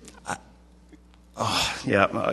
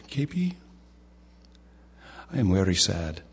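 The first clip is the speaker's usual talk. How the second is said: put-on halting Indian-accented storytelling with long pauses; K P Yohannan. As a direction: imitate an Indian preacher relaying gentle disappointment. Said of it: "in his little Indian accent".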